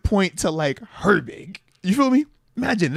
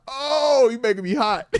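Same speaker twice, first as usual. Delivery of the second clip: laughing frustration; 7 semitones higher than usual. Retold or imitated. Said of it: "I'm like".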